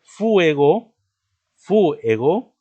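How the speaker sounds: fuego split as FU-e-go, high, loud stressed u; wrong hiatus pronunciation. Shown as a demonstration. Teacher showing students a hypothetical hiatus, u stressed loudly. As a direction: punch the u in fuego as its own syllable, FÚ-ego. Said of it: "Si dijéramos o pronunciáramos".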